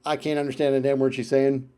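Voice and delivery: ordinary talk, nothing marked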